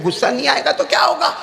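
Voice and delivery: high, insistent indignant tirade; acted anger. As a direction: protest like the insulted hakim, pitch climbing. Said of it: "ابو علی سینا تو غصے میں تھے".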